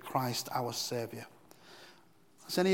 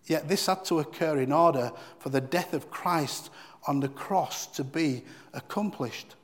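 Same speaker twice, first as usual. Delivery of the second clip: ordinary talk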